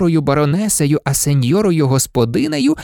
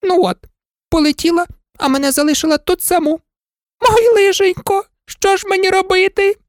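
much higher lamenting maid; forlorn old maid; 13 semitones higher, 3 dB louder than usual. Acted. Abandoned maid laments alone, high. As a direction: wail at being left.

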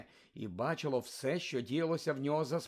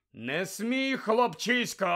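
roar; 7 semitones higher, 4 dB louder, slower than usual. Acted. Moody roaring at Malfoy.

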